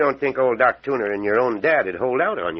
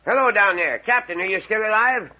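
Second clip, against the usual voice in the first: calling out; 9 semitones higher than usual. Acted. Doctor calls down into cellar.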